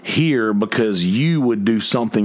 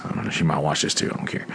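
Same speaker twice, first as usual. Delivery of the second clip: softer, fast muttered aside; quick quiet aside; 6 semitones lower, 6 dB quieter, faster than usual. Real, not acted. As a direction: drop your voice and rush it, half to yourself. Aside about girl possibly watching.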